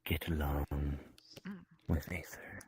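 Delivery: whispered aside. Acted. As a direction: breathe it as a whisper.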